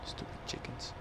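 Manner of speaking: breathy unvoiced grumble at the chickens; whispered annoyance at the game chickens; muttered aside. Real, not acted. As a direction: mutter it under your breath.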